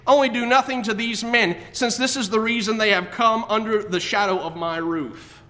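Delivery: loud, preached Bible reading; scripture reading. Acted. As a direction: proclaim the verses to the congregation. Reads scripture aloud at force.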